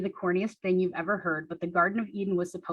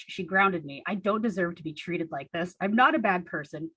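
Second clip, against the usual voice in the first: usual speaking voice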